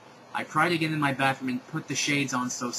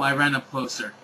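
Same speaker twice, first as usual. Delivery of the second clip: plain reading voice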